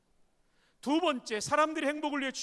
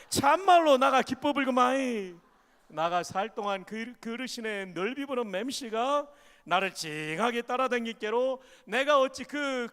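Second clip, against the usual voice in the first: loud, exuberant Jeolla dialect reading; 4 semitones higher, 6 dB louder than usual. Shown as a demonstration. Pastor reads Psalm 23 to the church in Jeolla dialect. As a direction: read it like a hearty Jeolla grandma. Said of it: "전라도 버전입니다".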